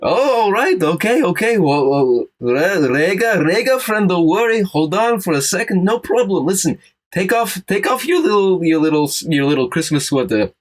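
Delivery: calm the frightened reindeer like a grandfather; reassuring old rabbi. Acted.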